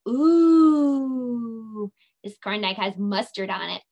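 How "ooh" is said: loud rising ooh of delight. Shown as a demonstration. Teacher showing kids the circus food sound, a loud delighted ooh. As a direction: ooh like you can't wait to taste it. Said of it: "can you say".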